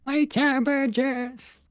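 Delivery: slow, high anguished wail; cabbage merchant wail. Retold or imitated. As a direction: drag it out like a distraught merchant. Quoting Avatar cabbage merchant.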